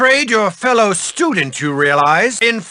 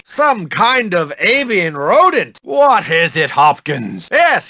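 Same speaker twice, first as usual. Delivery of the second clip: ordinary talk, nothing marked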